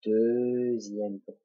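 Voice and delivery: very slow, stretched to follow the pen; drawn out. Shown as a demonstration. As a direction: draw the first word out long while tracing. Narrating the stroke while drawing it. (très lent, étiré)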